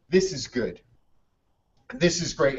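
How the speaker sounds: hushed, satisfied aside; quiet contentment. Retold or imitated. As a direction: savor it quietly, dropping the voice. Old man's contented thought over coffee.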